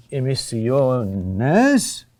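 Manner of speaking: emisiones drawn out on o, swooping up on nes; stress on second-to-last syllable. Shown as a demonstration. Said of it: "the accent is on the second to last syllable".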